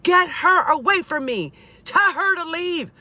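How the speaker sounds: high, loud frantic father impression; imitated screaming. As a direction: yell it out as Dad demanding help. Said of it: "He's screaming".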